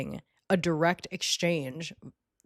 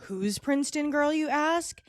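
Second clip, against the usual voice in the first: mocking skit announcer; 6 semitones higher than usual. Stepsisters revealing Princeton Girl to the whole rally, mocking. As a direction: act out a gleeful public unmasking. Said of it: "at the end they go".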